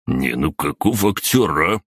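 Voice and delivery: ordinary delivery, nothing marked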